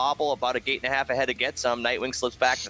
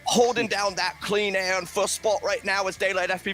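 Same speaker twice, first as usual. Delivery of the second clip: mock British accent, race call pitched higher; 4 semitones higher than usual. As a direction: keep the posh British caller going. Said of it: "in a British accent".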